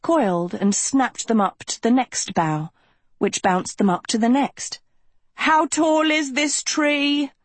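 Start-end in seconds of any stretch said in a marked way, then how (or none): 5.5-7.3 high, drawn-out shriek. A narrator's reading. Agatha shrieks while bouncing up the branches, long high shriek. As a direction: wail it slowly, pitched way up. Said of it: "Agatha shrieked".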